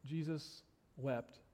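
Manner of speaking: hushed, slow reading; solemn hush. Acted. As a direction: let it land, slow and quiet.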